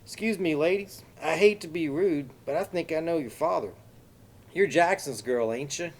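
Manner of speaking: exaggerated Texas accent, high and loud. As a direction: lay on a thick Texas accent, overly polite. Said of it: "said in an exaggerated Texas accent".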